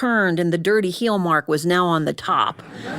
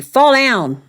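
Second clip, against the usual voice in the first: loud demo; 3 semitones higher, 7 dB louder than usual. Shown as a demonstration. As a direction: call out Fall down loudly. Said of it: "teach you one line that you need to say".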